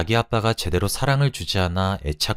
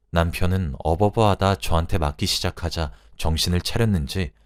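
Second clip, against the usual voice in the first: plain reading voice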